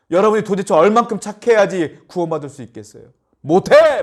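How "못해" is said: high, loud cry; exclaimed. Real, not acted. Preacher exclaims.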